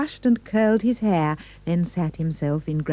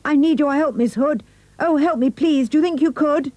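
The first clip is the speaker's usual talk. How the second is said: high, louder piglet plea; pleading piggy; 9 semitones higher, 4 dB louder than usual. A narrator's reading. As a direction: beg for help in a squeaky voice. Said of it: "Pig cried".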